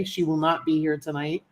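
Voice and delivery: normal talking voice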